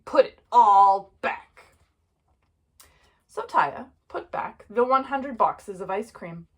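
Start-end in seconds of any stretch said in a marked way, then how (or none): 0.1-1.4 loud, spaced-out command; stern, emphatic telling-off; firm order. A narrator's reading. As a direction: lay down the law as a dad. Father orders child.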